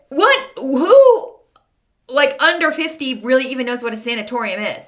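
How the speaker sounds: high, loud disbelieving squeal; incredulous. Retold or imitated. Shopper reading a strange air freshener label, shrieking in disbelief.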